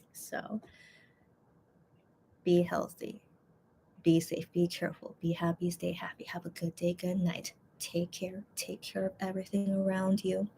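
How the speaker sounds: soft whispered goodnight; whispering. Real, not acted. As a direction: breathe the goodbyes softly.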